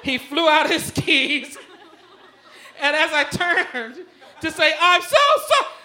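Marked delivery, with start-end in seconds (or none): 4.8-5.7 high, loud, laughing apology; shouted apology. Retold or imitated. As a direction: yell sorry while still sliding. Apologising to kid mid-crash.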